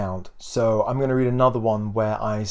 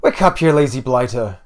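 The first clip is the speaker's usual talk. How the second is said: put-on loud Irish-accented wake-up call; scolding mother with an odd Irish lilt; weird Irish accent; 7 dB louder than usual. A narrator's reading. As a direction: rouse a lazy son in a rough Irish accent. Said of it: "a weird Irish accent".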